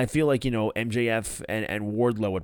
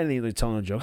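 ordinary delivery

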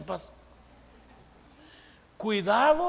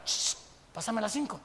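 mock whisper; 6 dB quieter than usual. Student asks for an answer during exam.

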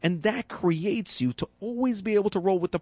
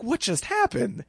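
curious wonder; 7 semitones higher, slower than usual. Retold or imitated. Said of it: "a guy who faces life with curiosity".

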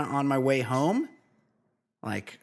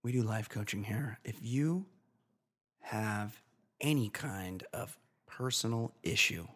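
hushed promo voice; 8 dB quieter than usual. Acted. Hushed pitch for life coaching.

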